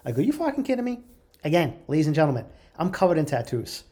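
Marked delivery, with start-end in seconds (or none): 0.2-1.0 high-pitched incredulous question; retold disbelief. Patient to nurse about the surprise IV, incredulous. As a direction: push back in disbelief, pitched right up.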